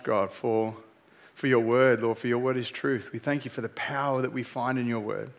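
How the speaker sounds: low, soft prayer voice. Real, not acted. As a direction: pray gently and sincerely to God. Pastor leads opening prayer.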